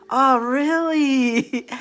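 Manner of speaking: a hammed-up sarcastic oh really; sarcastic drawl. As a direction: stretch it out with sarcasm. Mocking reply to bully.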